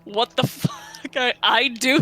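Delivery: words burst out through laughter; can't get it out for laughing. Real, not acted. Player cracking up at the table, laughing.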